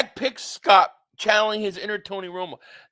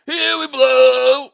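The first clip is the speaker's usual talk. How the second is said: loud, slow, drawn-out sneer on "blow"; mocking announcer call; 4 semitones higher, 10 dB louder, much slower than usual. Streamer jeering the broadcast catchphrase, loud slow mock call. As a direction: belt the catchphrase as a jeer, louder, slow. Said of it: "can I get a "here we go"?".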